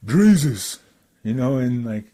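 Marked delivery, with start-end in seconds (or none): 0.1-0.8 Ernie's drawn-out, louder greeting; friend's crazy talk. Retold or imitated. Photographer greeting the rapper, lightly imitated crazy talk. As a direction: call out the name like an excited friend. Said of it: "the way he talks is just, like, it's crazy".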